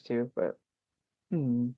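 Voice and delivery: ordinary talk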